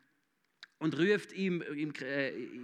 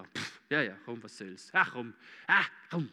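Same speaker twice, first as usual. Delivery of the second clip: resigned; 8 semitones lower than usual. Retold or imitated. Friends give in to Peter.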